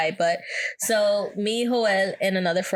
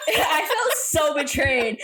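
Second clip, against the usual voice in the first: laughing lament; 7 semitones higher, slower than usual. Real, not acted. Laughing about own hurt.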